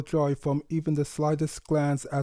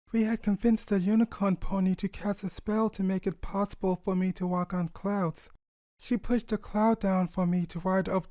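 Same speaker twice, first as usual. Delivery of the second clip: a higher, lively voice for the friendly mare; higher mare voice cheering on a nervous father-to-be; fond mare friend; 4 semitones higher than usual. Acted. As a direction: reassure him like an old friend, with a fond tease. Chili comforts Sunburst, reminisces.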